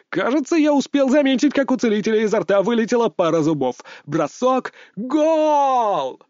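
excited sports commentator, high pitch; play-by-play commentary. Acted. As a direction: call the match live. Commentator announcing the match.

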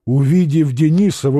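usual speaking voice

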